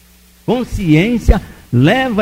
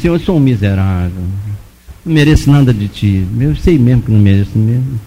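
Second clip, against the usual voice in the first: low, contrite confession; confissão encenada; 7 semitones lower than usual. Retold or imitated. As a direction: confess your wretchedness in a low voice. Acted confession of sins to God. (confissão grave e arrependida, encenada)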